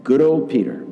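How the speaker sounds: normal talking voice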